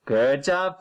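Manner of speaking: ordinary talk, nothing marked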